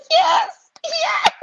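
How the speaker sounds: high squealing shout of yes; delighted scream while laughing; screaming with joy. Retold or imitated. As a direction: shriek it out, laughing and thrilled. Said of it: "we started screaming".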